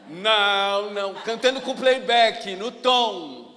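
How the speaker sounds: loud, stunned exclamation of disbelief; amazed disbelief. Retold or imitated. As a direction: blurt it out, floored by the surprise. Shocked to hear her singing. (espanto em voz alta)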